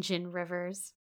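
ordinary delivery, nothing marked

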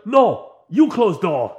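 slow, loud broken English retort; mock broken English. Short roommate turns back and retorts slowly in broken English. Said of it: "turns back and says".

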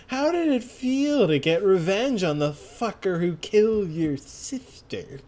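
put-on villain voice, words stretched out; taunting villain, hammed up in a strange voice; Sports Max. Villain taunts Hermes about her sister, put-on voice. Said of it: "trying to get into the Sports Max voice".

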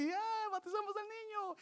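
high squealing party guest, mocking; mock party parent. Pastor mocking parents at a baptism party, mock squeal. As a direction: ham up a tipsy celebrating parent.

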